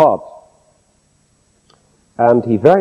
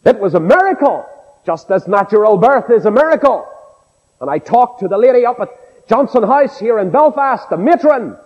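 raised-pitch pulpit testimony; impassioned preaching; 8 semitones higher than usual. Acted. Preacher testifies to the miracle of birth, impassioned preaching. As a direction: tell it with a preacher's fervour.

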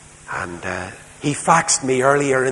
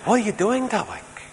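high, light imitation of a three-year-old; lightly voiced small child asking; little boy; 9 semitones higher than usual. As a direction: mimic a curious toddler. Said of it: "Only he calls me Derek".

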